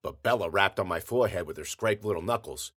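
ordinary delivery

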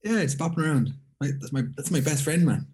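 ordinary talk

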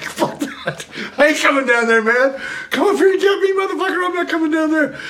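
high, loud, laughing defiant yell from the stairs. Retold or imitated. Yelling down at the cop from a locked stairway, high and laughing. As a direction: shout it down the stairs, half laughing. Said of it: "I'm like".

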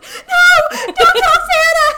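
put-on a scared kid begging, half laughing; panicked child. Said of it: "And I was like".

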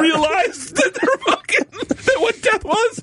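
can barely get the words out laughing; laugh-crying through the story; laughing hard. Real, not acted.

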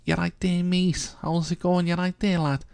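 high-pitched, exaggerated Scouse greeting; mock Scouse accent. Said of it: "She hasn't got, like, a Scouse, Scouse accent".

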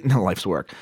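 ordinary talk, nothing marked

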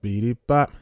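beeping robot theme jingle; toy robot voice. Acted. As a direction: chant it like a little toy robot. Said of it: "That was our theme song".

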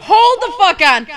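loud interruption. Real, not acted. Interrupting friends.

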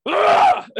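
sudden high loud "Ah!" roar; imitated yell. Imitating the goalie yelling after another goal, loud and high. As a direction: bark out a sharp frustrated cry.

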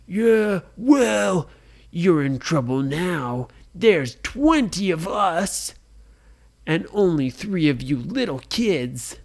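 loud, slow pirate taunt; threatening pirate. A narrator's reading. Pirate threatening the three kids, loud taunt. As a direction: taunt them like a bully pirate.

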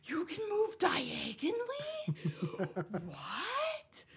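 high, slow, amazed disbelief; baffled and laughing at the discovery; surprised. Retold or imitated. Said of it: "I'm like".

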